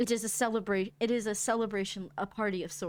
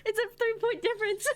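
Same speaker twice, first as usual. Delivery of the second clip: high, loud, half-crying plea; whiny plea; 13 semitones higher, 5 dB louder than usual. Real, not acted. Player begging the GM for a reroll, whining. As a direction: beg with a laughing whine.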